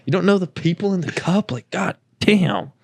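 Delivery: imitating the sibling annoyed while half-asleep; mock sleepy annoyance. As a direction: scold them for not knowing, playing it up. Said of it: "got, like, mad".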